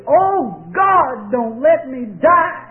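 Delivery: loud, high desperate cry; retold dying scream. Preacher voicing dying patients screaming to God. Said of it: "they would scream".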